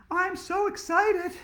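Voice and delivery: high, slow, squealing glee; gleeful excitement. Acted. Andrea gloating over the money, high gleeful squeal. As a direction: squeal it out while rubbing your hands. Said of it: "rubbing her hands together".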